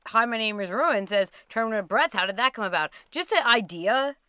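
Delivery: ordinary talk, nothing marked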